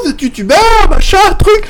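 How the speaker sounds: squeaky high imitation, impressed; high excited mock owner. Imitating the café owner discovering he's a youtuber, high and excited. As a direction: pitch way up like a starstruck fan. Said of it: "elle fait, oh".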